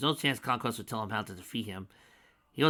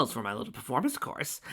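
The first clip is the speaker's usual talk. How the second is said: usual speaking voice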